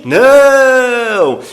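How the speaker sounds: loud emphatic Não!; firm loud denial. Real, not acted. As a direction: shout the answer no. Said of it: "Eu aconselho você a falar inglês assim?".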